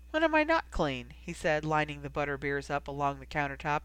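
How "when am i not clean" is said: mock indignation. A narrator's reading. Brother answering sister's teasing.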